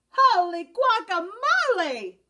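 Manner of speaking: high, loud, drawn-out cry of surprise; startled exclamation. A narrator's reading. Kitten startled by the mirror, high loud cry. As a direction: gasp it out at the strange sight. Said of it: "exclaimed Skippy John Jones".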